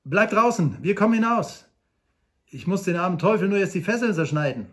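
calling out. Acted. Frank calls out to the rescuers from the cave, loud call. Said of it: "rief Frank ihnen zu".

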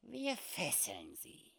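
put-on high, slow old trapper; calm squeaky trapper plan. Sam quietly gives the plan.

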